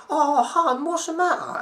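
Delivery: high, cooing mock concern; mock sympathy. Mocking a Facebook friend with cooing concern. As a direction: coo it like a fake-caring friend.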